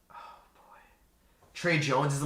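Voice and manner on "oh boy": breathy whispered nervous sigh. Real, not acted. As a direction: breathe it out under your breath. Nervous wait for the pick.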